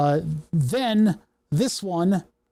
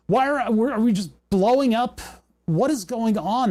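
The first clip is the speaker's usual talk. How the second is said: frustrated disbelief; 6 semitones higher than usual. Real, not acted. Circuit failing again, frustrated.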